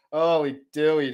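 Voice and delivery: usual speaking voice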